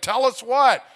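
high, loud shouted question; calling out. Retold or imitated. Someone in a gathered Marriott crowd asking the girl, calling out. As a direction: yell it as an eager onlooker.

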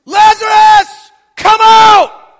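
huge high drawn-out shout; loud call. Acted. Jesus calling Lazarus from the tomb door, a huge shout. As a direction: call him out of the tomb with everything. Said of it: "And in a loud voice".

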